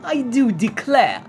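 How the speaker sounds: high, drawn-out mock upper-class accent; hammed-up first-class accent, slow and high; put-on posh period accent. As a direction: play a rich nineteen-hundreds passenger's accent. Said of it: "continue my first impressions video in this tone".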